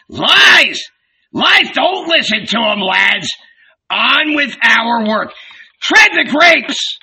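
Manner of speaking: high, blustering tirade; old boss in a loud temper; enraged. A narrator's reading. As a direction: rant indignantly, bursting into shouts. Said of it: "he turned to Andrew in a rage".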